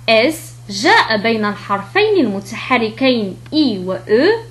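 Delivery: ordinary talk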